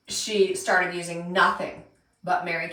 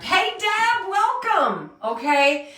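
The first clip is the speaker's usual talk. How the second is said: high, eager greeting; bright welcome; 9 semitones higher, 3 dB louder, much slower than usual. Real, not acted. Host greeting a viewer joining her live stream, high and eager. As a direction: call out a happy hello.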